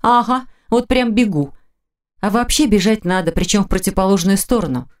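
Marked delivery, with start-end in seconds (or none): 0.0-1.5 sarcastic. A narrator's reading. Heroine's sarcastic inner retort.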